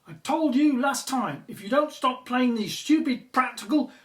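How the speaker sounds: loud, high-pitched scolding; yelling at the pranking kids; angry telling-off. Acted. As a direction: shout them down for the pranks. Scolding kids over prank noises.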